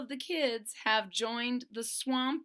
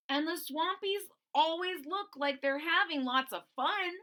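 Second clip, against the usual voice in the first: higher, eager voice; eager Terry; 7 semitones higher than usual. Acted. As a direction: chime in excitedly.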